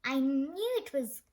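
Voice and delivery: ordinary delivery, nothing marked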